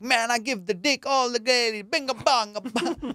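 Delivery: put-on high Jamaican-accented voice, "Binga bonga bang" slowed. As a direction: ham up a young Jamaican player bragging. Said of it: "He's like".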